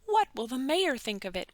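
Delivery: ordinary delivery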